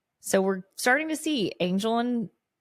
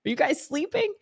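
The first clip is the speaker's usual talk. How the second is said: mock angry Spike; 11 semitones higher than usual. Imitating Spike yelling at minions.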